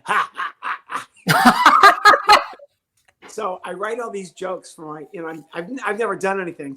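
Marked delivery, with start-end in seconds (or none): 0.0-2.4 high forced cackle, loud; hammed-up laugh of dad; mocking high laugh. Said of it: "My dad thought".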